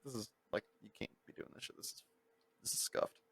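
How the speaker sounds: whispered grumble; muttered. Retold or imitated. Rider grumbling about the driver, whispered. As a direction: grumble it under your breath.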